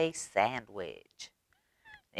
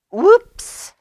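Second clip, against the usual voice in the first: high, loud playful "Whoops"; hammed-up surprised little girl; 11 dB louder than usual. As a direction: squeal it like a kid caught spilling. Ivy spills cornflakes.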